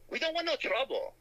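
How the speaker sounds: imitating the wary store owner in a mock Chinese accent. Store owner to the hoodlums, mock Chinese accent. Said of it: "He goes".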